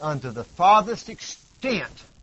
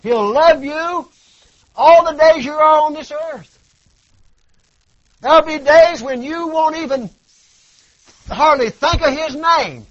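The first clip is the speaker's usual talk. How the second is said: full-force preaching; 10 semitones higher, 10 dB louder than usual. Acted. Preacher crying out God's love to the church, full force. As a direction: preach it out over the congregation.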